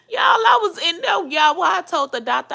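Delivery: high, loud, fast outburst; excited shout. Real, not acted. Telling her viewers about the doctor, loud and excited. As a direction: blurt it out at the top of her voice.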